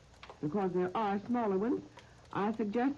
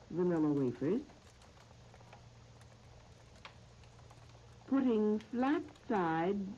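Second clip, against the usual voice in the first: slow, measured phrases with long pauses; recipe dictation; slower than usual. Real, not acted. Radio host reading out a recipe to listeners, slow dictation. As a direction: dictate it piece by piece, leaving time to write.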